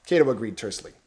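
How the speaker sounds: ordinary delivery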